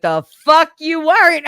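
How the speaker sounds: high, loud mock yell; re-enacted indignant shout; retold outraged retort. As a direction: fire back loudly in disbelief. Retold retort in screaming argument.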